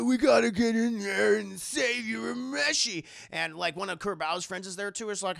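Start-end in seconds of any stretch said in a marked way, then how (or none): 0.0-3.0 Kuwabara voice. Acted.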